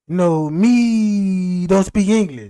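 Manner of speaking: slow, loud mock broken English. To a neighbor who can't understand, slow mock broken English. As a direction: drag it out like a man with no English. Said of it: "he was looking at me like".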